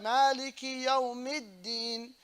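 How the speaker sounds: slow "Maliki Yawmiddin" with held double d; measured recitation, d doubled in "Yawmiddin"; shadda on dal. Shown as a demonstration. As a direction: draw out "Maliki Yawmiddin", pressing the d. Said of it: "on the dal".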